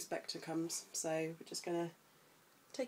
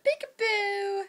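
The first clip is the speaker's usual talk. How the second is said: high, loud singsong burst; playful peekaboo; 14 semitones higher, 9 dB louder, much slower than usual. Real, not acted. Mum making baby giggle, high excited peekaboo.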